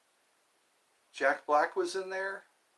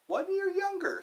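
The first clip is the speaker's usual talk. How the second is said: surprised. Real, not acted. Amazed at age gap.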